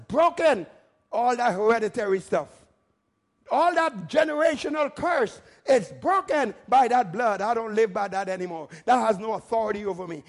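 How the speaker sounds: emphatic preacher declaring with conviction; forceful preaching. Real, not acted. Preacher renouncing the generational curse, forceful preaching. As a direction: punch out the key words like a revival preacher.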